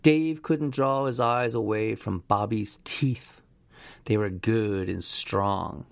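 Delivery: ordinary delivery, nothing marked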